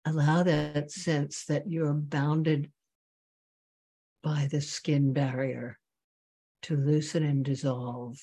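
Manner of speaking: slow meditation voice with long pauses; guided meditation. Real, not acted. Teacher bringing students back to the breath, guided meditation. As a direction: guide them gently inward, leaving long silences.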